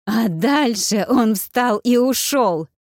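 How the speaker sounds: drawn-out, emphatic angry snarl; angry growl. A narrator's reading. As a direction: growl it out slowly, leaning on each word. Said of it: "прорычала Вэл".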